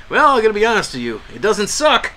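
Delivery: mock celebrity sister. Host imitates an Olsen sister answering a fan, breezy and high. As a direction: toss it off like a spoiled rich sister. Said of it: "She's like".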